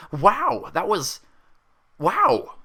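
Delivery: high, loud, breathless wow; excited exclaim. Acted. Pinkie awestruck at the gasp, gushing loud and high. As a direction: gush in amazement. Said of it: "Pinkie exclaimed".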